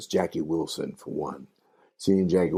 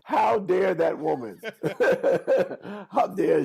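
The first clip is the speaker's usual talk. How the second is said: laughing. Real, not acted. Laughing at his mother's crush.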